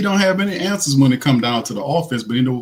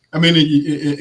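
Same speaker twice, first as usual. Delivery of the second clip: ordinary delivery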